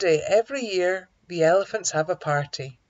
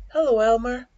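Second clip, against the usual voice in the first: higher, louder hello; animals' greeting; 6 semitones higher, 6 dB louder than usual. A narrator's reading. Animals call a greeting to Elmer.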